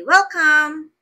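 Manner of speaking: teacher demoing a warm, loud welcome to passengers; cheerful welcome. Shown as a demonstration. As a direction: sing out a big welcome to arriving guests. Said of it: "You can say".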